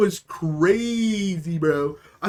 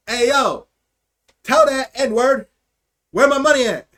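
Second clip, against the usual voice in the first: loud, higher voicemail demand; drunken call-out; 3 semitones higher, 6 dB louder than usual. Retold or imitated. Drunk teen leaving a truck driver a voicemail, loud call-out.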